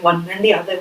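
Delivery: normal talking voice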